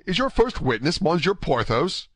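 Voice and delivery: high-pitched, incredulous query; astonished question. A narrator's reading. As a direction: ask in open amazement. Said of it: "cried D'Artagnan".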